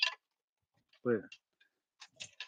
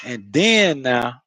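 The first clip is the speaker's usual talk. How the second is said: drawn-out Florida "then now", slow and loud; Florida accent; 5 dB louder, much slower than usual. Shown as a demonstration. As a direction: stretch it like a Florida local. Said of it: "that's how we say it in Florida".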